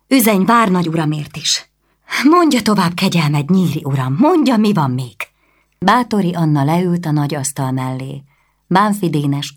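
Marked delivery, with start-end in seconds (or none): 0.1-5.1 higher, louder impatient lady; commanding noblewoman. Acted. Anna, pressing Nyíri for news, higher and louder commanding. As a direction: demand the news at once.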